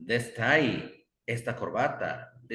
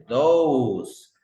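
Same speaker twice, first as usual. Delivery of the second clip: those said loud and higher; pointing out those; 4 semitones higher, 10 dB louder than usual. Shown as a demonstration. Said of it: "él señala y dice". (those fuerte, señalando)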